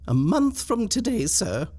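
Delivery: high, polite maid giving notice; a young servant stating terms, pitched high; parlour-maid voice. Acted. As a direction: maid handing in her notice. Maid gives notice to master.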